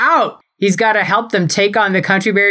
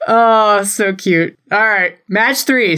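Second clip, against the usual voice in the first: ordinary talk, nothing marked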